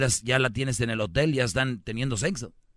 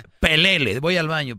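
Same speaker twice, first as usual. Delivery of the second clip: scornful loud insult; 4 semitones higher, 6 dB louder than usual. Retold or imitated. Novia insulta al novio.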